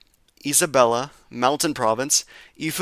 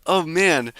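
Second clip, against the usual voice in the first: ordinary talk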